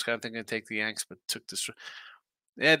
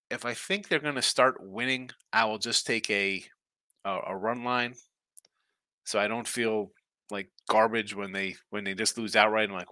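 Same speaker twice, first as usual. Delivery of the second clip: normal talking voice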